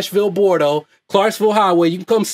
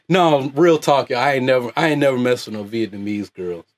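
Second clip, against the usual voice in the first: usual speaking voice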